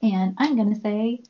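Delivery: ordinary talk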